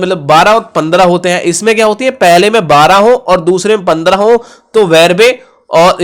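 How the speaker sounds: ordinary talk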